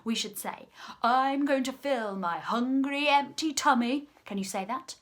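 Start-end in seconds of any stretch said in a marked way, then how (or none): 1.0-4.1 loud, raised, slow crocodile line; biggest, loudest voice. Shown as a demonstration. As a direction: call it out for children to copy. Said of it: "loudest voices".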